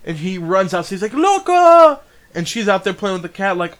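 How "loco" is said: loud, high drawn-out name call. Retold or imitated. Boy running outside to find her, calling out. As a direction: holler for someone missing, hammed up.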